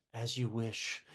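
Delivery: soft, low, devoted murmur; tender devotion. Retold or imitated. Wesley answering Buttercup, soft and devoted. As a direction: say it like a loving servant, hushed. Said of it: "who's basically says".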